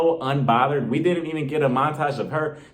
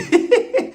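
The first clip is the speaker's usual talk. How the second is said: a sudden squealing laugh, higher and louder; high burst of laughter; 6 dB louder than usual. Real, not acted. Laughing at her own impression to the camera. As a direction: laugh out loud at the joke.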